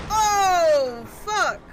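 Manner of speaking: very loud startled yell; loud alarmed shout. Real, not acted. Gamer ambushed by enemies, very loud shout.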